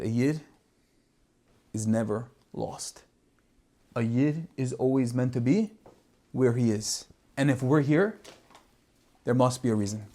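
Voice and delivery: soft, low, serene conviction; hushed steady comfort; calm and composed. Retold or imitated. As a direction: reassure him quietly that all is meant. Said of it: "completely composed".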